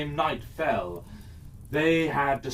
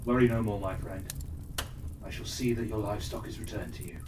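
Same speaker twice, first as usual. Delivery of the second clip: reassuring Endar; 5 semitones lower, 7 dB quieter, much faster than usual. Acted. Endar reassures the frightened elf.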